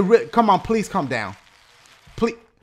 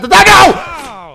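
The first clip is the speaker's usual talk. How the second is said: stretched-out, higher, louder cry of no; panicked no; 7 semitones higher, 7 dB louder, much slower than usual. Real, not acted. Gamer watching his rider crash, panicked. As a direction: drag out a horrified no.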